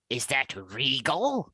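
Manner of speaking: slow, stiff line reading; a hint of a bad regal actor; bad acting. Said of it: "deliver the line like this".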